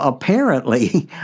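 usual speaking voice

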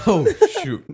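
high startled cry after a sip; shocked yelp. Real, not acted. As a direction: yelp in disgust at the taste. First sip of drink.

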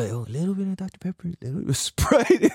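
put-on a light Filipino uncle accent, soft and fast; Filipino accent attempt. Uncle revealing recipe, mild Filipino accent. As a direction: try a Filipino uncle, quickly and quietly. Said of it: "can't I not do Filipino accent".

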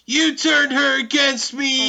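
high, loud hammed-up accusing shout; Anakin Skywalker. Accusing a mentor of turning her, melodramatic yell. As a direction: wail it at full volume, over the top. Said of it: "some real Anakin Skywalker vibes".

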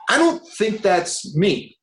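high, slow, hesitant pushback; retold polite refusal. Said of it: "And I went".

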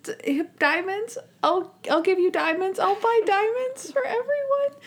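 rising panic as he promises diamonds; panicky Monk. Retold or imitated. Monk rattled by the coal joke, high panicky promise. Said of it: "He's like".

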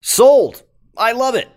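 bursting with delight; excited. Acted. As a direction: jump up, totally sold. Host thrilled by sixth-grade plot.

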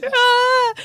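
high sudden ah of relief; relieved gasp. Retold or imitated. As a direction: gasp as she spots her. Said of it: "I was like".

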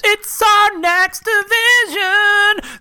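high, slow, drawn-out announcement; dramatic announcer. Pretend. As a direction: stretch it out like a game show host. Dramatic reveal of next round.

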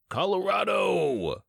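very high, drawn-out exultant shout; triumphant cry. Acted. Benny lifts his hands in triumph, high drawn-out cry. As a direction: throw it up like a victory.

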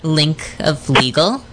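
Linke Fliegel drawn out slowly, rising at the end; slow, careful repeat of Linke Fliegel. Shown as a demonstration. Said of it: "Say it slowly".

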